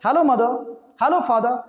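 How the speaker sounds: ordinary talk, nothing marked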